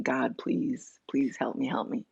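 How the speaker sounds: soft, hushed pleading to God; imitating herself begging on her knees, quiet and desperate; retold desperate begging. Praying to God on her knees, softly begging. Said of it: "on my knees begging".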